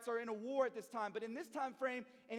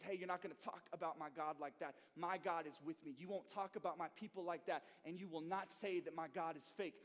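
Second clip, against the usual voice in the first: calm defiance; 6 semitones lower, 6 dB quieter than usual. Retold or imitated. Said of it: "he says".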